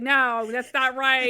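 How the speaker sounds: loud, slow correction to a phone; mock exasperation. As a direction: imitate an exasperated mom. Acting mom correcting the phone.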